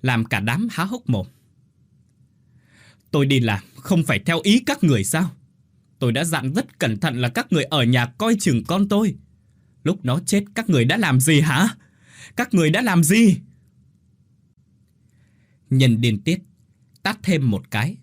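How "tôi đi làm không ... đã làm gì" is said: higher, louder, rising to a scream; tearful shouting. A narrator's reading. Grieving mother screams at the family, tearful rage. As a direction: scream the accusation back at them. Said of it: "rưng rưng nước mắt gào lên".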